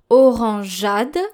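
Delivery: drawn-out French "Orangeade", the e silent; French pronunciation. Shown as a demonstration. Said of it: "let's take the word orangeade".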